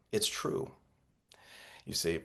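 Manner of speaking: whispered aside, no voice. Real, not acted. Teacher whispering to his students about truth. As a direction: hush it like a secret to the audience.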